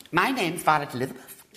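put-on lisping little girl. A narrator's reading. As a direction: lisp it like a spoilt small girl. Little girl voiced in story.

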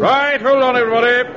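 high, loud call to the crew. Acted. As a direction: warn everyone to hold on. Calling out to crew before start.

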